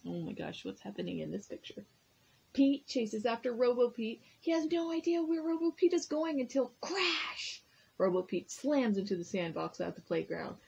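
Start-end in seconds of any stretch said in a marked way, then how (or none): 4.4-7.6 dramatic buildup. A narrator's reading. Crash climax of story.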